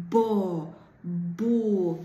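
"bo" and "bu" said loud and separate; exaggerated therapy syllables; syllable demo. Shown as a demonstration. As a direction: sound out each syllable loudly for the child. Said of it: "chcemy uruchomić te trochę sylaby".

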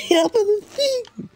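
laughing helplessly mid-sentence; words breaking into high laughter. Real, not acted. Cracking up at clumsy horse.

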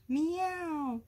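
high, drawn-out meow; long imitated meow; cat yowl. A narrator's reading. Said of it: "Cora yelled".

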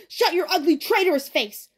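high, loud screamed insult. A narrator's reading. Crazed Ben at Thomas the supposed traitor, screaming. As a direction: scream it with venom. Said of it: "Ben screamed".

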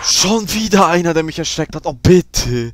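loud, high panicked begging; panicked pleading. Real, not acted. Gamer scared again, loud panicked pleading.

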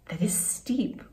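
hushed storybook aside; soft whisper. Acted. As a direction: breathe it out quietly. Story read aloud.

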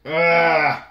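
high, loud groan of disgust. Real, not acted. Collector groaning in disgust at the ruined set, loud. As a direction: let out a fed-up ugh. After damaged card.

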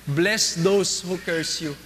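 mabagal at mahinang pagbigkas ng "Bless those who curse you"; slow quote. Shown as a demonstration. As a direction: bigkasin ang bawat salita nang dahan-dahan at may bigat. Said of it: "patignan nyo, mas matindi to". (dahan-dahang pagbasa ng talata)

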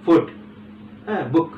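"গুড" in a single unbroken beat; "গুড" as one short word; said as one syllable. Shown as a demonstration. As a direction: say "গুড" all at once. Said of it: "একসাথে উচ্চারণ করতে পারছি".

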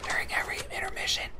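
whispering the rules to viewers. Real, not acted. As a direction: whisper the game's rule. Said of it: "Don't Wake the Neighbors".

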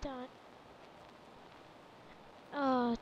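ordinary talk

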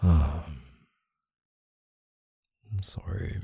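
tiny, whispered apology; hushed apology. Acted. Partner apologises after the worry, hushed apology. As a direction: let it drop to a whisper.

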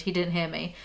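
normal talking voice

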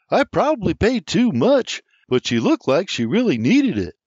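put-on young man recounting the street peddler; William explaining. A narrator's reading. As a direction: tell it eagerly, a lad's voice. William tells of the cart.

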